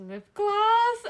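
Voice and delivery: high, loud, baffled reply; baffled answer. Retold or imitated. Retelling answer to creep about lips, baffled. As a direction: pitch it way up in mock disbelief.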